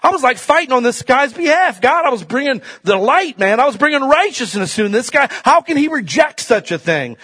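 loud, high, impassioned preaching; preacher fired up about his calling; preaching at full force. Acted. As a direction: build it with fervor.

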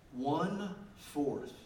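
drawn-out, weighty delivery; grave, stretched-out emphasis; slow emphatic repeat. Real, not acted. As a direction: let each word land slowly.

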